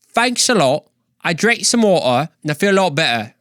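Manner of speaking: a cheeky Cockney voice; Cockney accent. Retold or imitated. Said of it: "some good Cockney accent".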